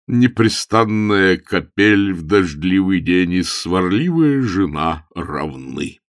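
slow, solemn scripture quotation; weary bishop. Acted. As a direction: intone the proverb ponderously.

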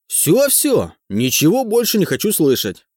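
excited. A narrator's reading. Teacher cuts him off.

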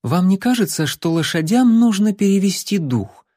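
high, silky, ingratiating voice; coaxing princess. A narrator's reading. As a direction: sweet-talk them into stopping. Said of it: "вкрадчиво произнесла принцесса".

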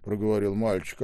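ordinary delivery, nothing marked

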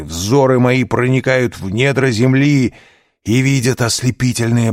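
rising, loud, prophetic fervour; like a visionary seeing treasure; fervent exaltation. A narrator's reading. Dying abbe's ecstatic vision.

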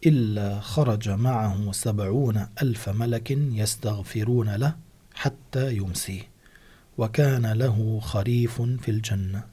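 measured, deliberate reading of the hadith; slow down for the quoted hadith; slow hadith quote. Acted. Host quoting the hadith slowly.

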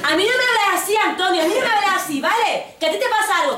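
very high, loud shout; screaming at boyfriend Antonio; shouting girlfriend. Retold or imitated. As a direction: yell it at him. Escalated quarrel, shouting.

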